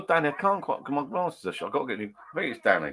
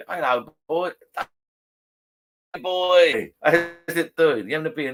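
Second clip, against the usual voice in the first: hearty pub greeting; 8 semitones higher, 5 dB louder, slower than usual. Retold or imitated.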